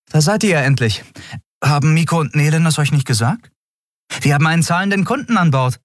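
high, quick character voice; breezy Endriel. A narrator's reading. Endriel announces a paying customer to the crew, high breezy voice.

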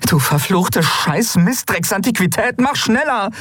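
high, frantic swearing at the ship; cursing. Acted. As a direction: curse the old machine to hurry. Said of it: "fluchte Endriel".

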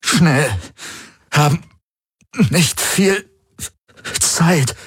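broken, high gasps through gritted teeth; breathless, strained urging with gaps; gasping. A narrator's reading. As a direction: pant each word out separately, urgently. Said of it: "keuchte er mit zusammengepressten Zähnen".

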